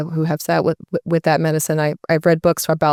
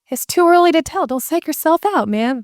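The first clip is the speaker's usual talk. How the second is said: laid-back friend; 10 semitones higher than usual. Retold or imitated. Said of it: "And he goes".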